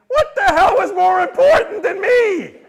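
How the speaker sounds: loud, high, slow mock indignation; mock outrage. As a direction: pretend to be offended, laughing.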